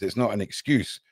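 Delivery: usual speaking voice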